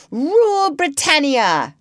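high, slow patriotic squeak; boy's high squeaky rallying cry. A narrator's reading. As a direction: squeak it out with all your courage. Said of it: "squeaked Curly".

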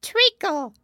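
high, loud instant answer; blurting dormouse. A narrator's reading. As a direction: squeak it out without thinking. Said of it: "without considering at all this time".

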